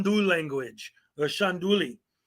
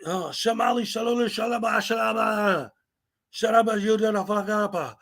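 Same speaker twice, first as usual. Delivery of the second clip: higher, hammed-up fake Arabic-sounding babble; mock fake gibberish; 4 semitones higher than usual. Mocking auditors' fake Shanduli talk, mimicking their gibberish. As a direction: ham up the nonsense syllables. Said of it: "Y'all never miss the Shanduli".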